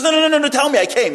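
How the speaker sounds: very high, loud string of protests; urgent pleading. Retold or imitated. Woman refusing to leave the rabbi, urgent pleading. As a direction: plead hard, refusing to be sent away.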